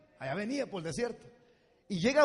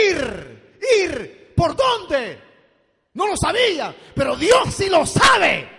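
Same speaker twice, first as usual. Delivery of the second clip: building from a raised voice to a very loud, high shout; rising preaching shout; 9 semitones higher, 15 dB louder, much slower than usual. Real, not acted. Pastor preaching to the congregation, building to a shout. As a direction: climb to full force on the last words. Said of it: "preaching climax".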